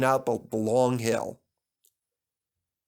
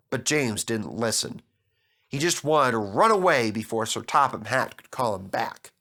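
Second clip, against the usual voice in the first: ordinary delivery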